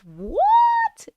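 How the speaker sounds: high, squeaky disbelieving what; shocked what. Real, not acted. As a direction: shoot up in disbelief. Hearing about shredded chicken crust.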